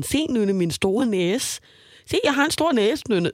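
high, slow silly coaxing; goofy cheering-up voice. Retold or imitated. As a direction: coax her like a clown. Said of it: "ved at sige sådan".

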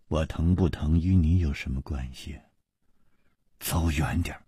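low, quiet, icy rebuff; colder still. A narrator's reading. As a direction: push them away coldly in a low voice. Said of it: "却比他更冷".

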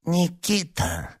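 high, slow, squeaky greeting; sly high-pitched creaky little voice; thin creaky voice. A narrator's reading. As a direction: draw out the name in a thin creak. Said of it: "раздался тонкий скрипучий голосок".

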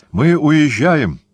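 high, drawn-out exclamation; excited cry. A narrator's reading. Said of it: "вскричал он".

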